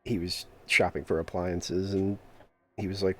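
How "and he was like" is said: ordinary talk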